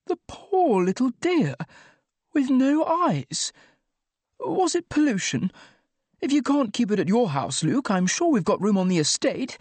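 high-pitched, pitying lament; tearful concern in a high, put-on voice; slightly teary. A narrator's reading. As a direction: plead for the poor thing, voice very high. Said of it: "had a slightly teary look".

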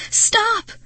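high, loud cry; panicked shout. A narrator's reading. Girl stopping Marty at the doorway, panicked. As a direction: yell it to halt someone in danger.

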